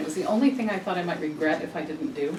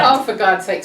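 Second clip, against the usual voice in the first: laughing; 8 dB louder than usual. Real, not acted. Laughing exasperation at herself.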